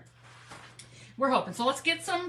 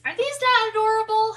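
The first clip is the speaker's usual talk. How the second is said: 1 delighted gush; 10 semitones higher, 7 dB louder than usual. Real, not acted. Showing off cute gourds.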